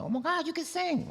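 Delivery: mock amazement. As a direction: gush it in a high, astonished put-on voice. Retold amazed reaction to his singing.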